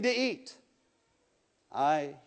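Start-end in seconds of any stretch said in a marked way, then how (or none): 1.7-2.1 a hint of a deep, softer lion voice; lightly voiced lion, calm and quiet; large voice. The lion answers the boy, a hint of a softer deep voice. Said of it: "said the large voice".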